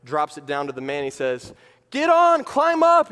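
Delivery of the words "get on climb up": high, loud rescuer's shout; acted urgent yell; urgent calling out. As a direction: shout down from a helicopter.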